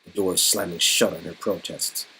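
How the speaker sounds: hushed low narration; scene narration. A narrator's reading. Game master closing the scene, softly. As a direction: let the scene fall quiet.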